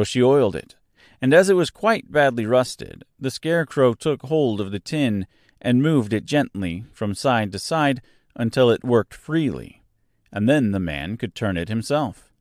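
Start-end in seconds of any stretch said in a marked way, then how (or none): none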